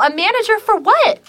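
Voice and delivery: high, loud-edged incredulous question; indignant question. Retold or imitated. Shopper challenging woman who asked for a manager, indignant. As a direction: pitch it way up in disbelief.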